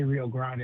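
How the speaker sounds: Grande as GRAHN-dee; disputed pronunciation. Shown as a demonstration. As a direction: open ah in Grande, ending on ee. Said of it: "I didn't say".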